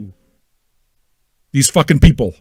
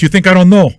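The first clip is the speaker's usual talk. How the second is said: ordinary talk, nothing marked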